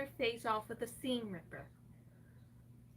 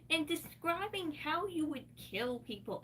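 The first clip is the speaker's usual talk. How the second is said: ordinary delivery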